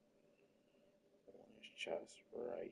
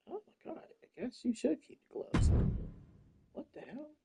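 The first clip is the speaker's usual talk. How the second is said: high, squeaky mock voice; mock disbelief; 14 semitones higher than usual. Telling the figure to keep its gloves in a high squeaky voice.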